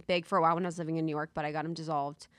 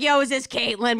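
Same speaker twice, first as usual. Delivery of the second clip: mock caller; 4 semitones higher, 9 dB louder than usual. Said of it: "Where it'd be like".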